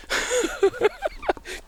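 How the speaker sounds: a high, squealing burst of laughter; high cackling laugh. Real, not acted. Laughing at one-eyed man.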